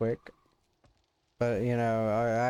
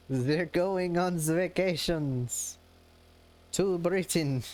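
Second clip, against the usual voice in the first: mock German accent; 4 semitones higher, slower than usual. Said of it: "He's like".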